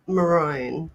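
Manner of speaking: "maroon" said muh-RONE; maroon with a long "o"; disputed pronunciation. Shown as a demonstration. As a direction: say maroon rhyming with bone. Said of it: "not how you spell maroon".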